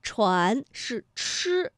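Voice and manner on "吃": 吃 as a drawn-out curled-tongue ch sound; retroflex initial. Shown as a demonstration. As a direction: hold 吃 with the tongue curled back. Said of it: "平翘舌音的连读". (把“吃”读成拉长的翘舌音)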